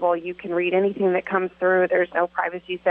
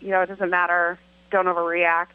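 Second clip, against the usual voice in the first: usual speaking voice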